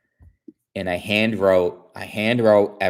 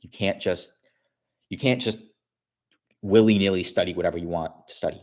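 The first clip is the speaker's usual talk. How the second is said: normal talking voice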